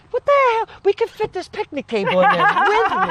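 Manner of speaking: incredulous outburst. Real, not acted. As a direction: blurt it out, half laughing in shock. Shocked by huge shirt.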